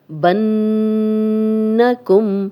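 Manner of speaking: بنکم held slowly with a nasal hum on the doubled nun; slow tajweed recitation with ghunnah. Shown as a demonstration. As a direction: hum the ن in بنکم through the nose. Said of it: "نون پہ شد ہے تو غنہ کریں گے".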